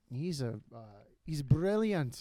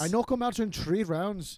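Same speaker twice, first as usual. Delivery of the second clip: higher, louder Irish boast; McGregor accent; 5 semitones higher, 5 dB louder than usual. Retold or imitated. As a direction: show him how McGregor says it. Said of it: "the McGregor".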